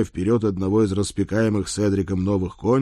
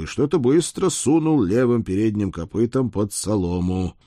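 ordinary delivery

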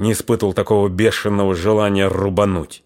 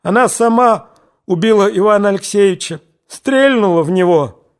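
indignant tears; 10 semitones higher, 4 dB louder than usual. A narrator's reading. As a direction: cry out in angry tears. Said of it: "негодующие слёзы".

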